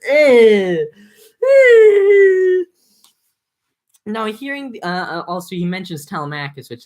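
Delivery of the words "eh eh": high, loud fake wail; mock sob. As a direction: ham up a wailing cry. Mock sob after whiny hero.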